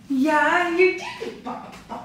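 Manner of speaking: squeaky cooing praise that trails off breathless; high cooing praise. Real, not acted. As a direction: praise a pet in a tiny high voice.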